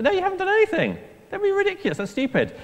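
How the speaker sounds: preacher mocking the boasting rangers, scoffing; scoffing retort. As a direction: knock the boast down with disbelief.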